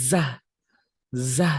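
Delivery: "যা" as "zaa", buzzed then released; thin z on "যা", let it go from the mouth; short buzzing "যা"; thin Arabic zaa. Shown as a demonstration. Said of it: "গাল থেকে আমাদের মুখ থেকে ছেড়ে দিতে হবে".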